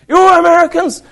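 high, loud exclamation, mimicked; excited stranger. Short man accosting visitors in the market, high excited shout.

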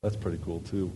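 low, very soft side remark; hushed, low aside; muttered aside. Real, not acted. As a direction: say it under your breath to yourself. Aside about the new clicker.

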